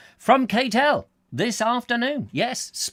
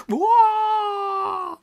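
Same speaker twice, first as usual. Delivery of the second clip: sudden yelp; 5 dB louder than usual. Acted. Sudden cry mid-chat.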